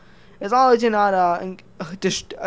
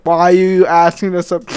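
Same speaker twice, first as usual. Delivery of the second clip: Kim Jong-un impression; 7 dB louder than usual. Kim Jong-un loudly demands why he is asked. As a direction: bark the question, offended. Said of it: "Kim Jong-un would say".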